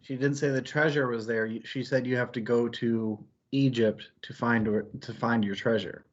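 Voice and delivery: ordinary delivery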